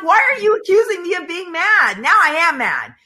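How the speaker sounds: high-pitched, exasperated protest; mock pissed off. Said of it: "And then I'm pissed".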